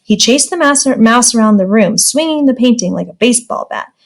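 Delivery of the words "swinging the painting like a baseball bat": plain reading voice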